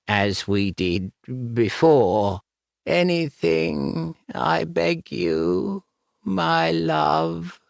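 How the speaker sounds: high, slow ghostly pleading; begging ghost voice. Acted. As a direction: beg him tenderly from the grave. Ghost begging her lover.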